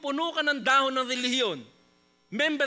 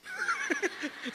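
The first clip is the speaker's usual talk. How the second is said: high mocking laugh. Mocking laugh after fake sorry.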